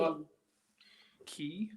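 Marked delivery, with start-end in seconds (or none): 1.2-1.7 whispered question, barely voiced. Real, not acted. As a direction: breathe the word as a question. Repeating a spirit box word.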